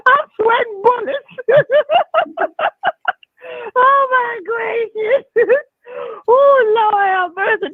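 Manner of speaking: real helpless laughter, nearly shrieking; laughing fit. Real, not acted. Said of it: "you're gonna make me wake my neighbors up".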